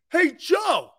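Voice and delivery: high, cheery mock boss greeting; mock generous owner. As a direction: ham it up as a suddenly generous owner.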